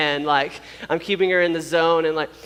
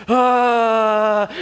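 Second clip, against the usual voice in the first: loud, higher, long held "aaah"; intense "ah"; 5 semitones higher, 6 dB louder than usual. Retold or imitated. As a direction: imitate a straining labor cry. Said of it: "started to get a little more intense".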